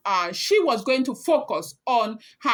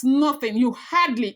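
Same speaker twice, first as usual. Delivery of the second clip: usual speaking voice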